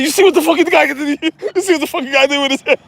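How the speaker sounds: fast, high, loud mock outrage; mimicked agitated guard. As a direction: rattle it off in high-pitched disbelief. Mimicking security's nervous reaction.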